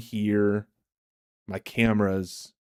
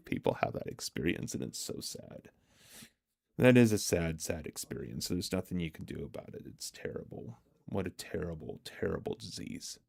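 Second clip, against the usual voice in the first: softer, half-whispered mock grief; mock sorrow; 4 semitones lower, 9 dB quieter than usual. Streamer teasing chat about being lost, soft mock sorrow. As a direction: hush it like fake condolences.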